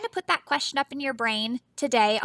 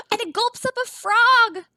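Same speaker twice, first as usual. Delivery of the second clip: higher, louder alarmed reading; excited gasp; 12 semitones higher, 7 dB louder than usual. Acted. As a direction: gasp and rush the line. Gasp at shark.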